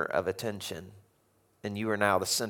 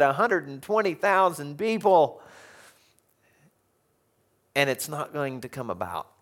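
ordinary talk, nothing marked

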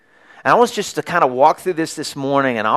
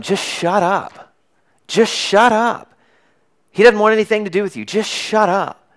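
belligerent crowd; 9 semitones higher than usual. Retold or imitated. Crowd tells the blind beggar to hush, annoyed. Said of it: "when someone gets belligerent".

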